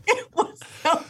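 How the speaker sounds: high, laughing storytelling; bursting with laughter at it; a giggly high-pitched telling; excited laughing retelling. Real, not acted. Excited retelling of wave pool.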